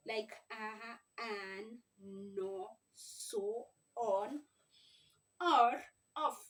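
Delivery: each short word sounded out slowly; sounding out words. Shown as a demonstration. Teacher to parents, sounding out sight words. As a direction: sound out ah, an, no, so, on, or, off one by one. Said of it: "sound them out".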